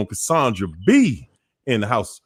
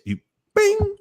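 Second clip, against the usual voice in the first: bing sound. Shown as a demonstration. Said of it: "demonstrating the like sound".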